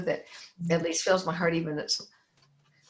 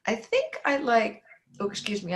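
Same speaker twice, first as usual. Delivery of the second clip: ordinary talk, nothing marked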